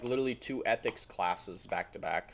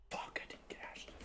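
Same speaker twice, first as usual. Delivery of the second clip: whispering; 18 dB quieter, faster than usual. Real, not acted. Muttered after glitch ends.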